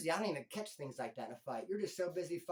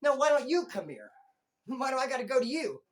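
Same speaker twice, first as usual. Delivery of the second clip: defiant retort; 5 semitones higher, 6 dB louder than usual. Retold or imitated. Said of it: "And I'm gonna".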